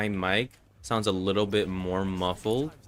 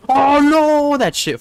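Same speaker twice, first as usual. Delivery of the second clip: loud high wail of dismay; long high shout, dropping into complaint; dismayed yell; 15 dB louder, much slower than usual. Real, not acted. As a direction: cry out as the game goes wrong. Yells as the game goes wrong.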